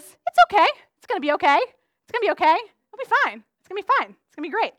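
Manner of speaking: sing-song optimism, brushing off worry; breezy reassurance. Retold or imitated. Telling herself all will be fine, breezy reassurance. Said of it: "mine is".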